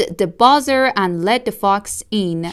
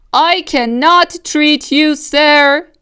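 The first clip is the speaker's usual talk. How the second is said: shouted; 7 semitones higher, 5 dB louder than usual. Acted. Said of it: "Doctor DeSoto shouted".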